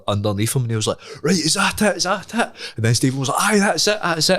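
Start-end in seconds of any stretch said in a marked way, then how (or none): 1.2-2.5 high, mock-tough taunt; taunting challenge. Retold fighter demanding surrender. 3.4-4.4 high, hammed-up pleading give-in; mock surrender. Retold boy giving up.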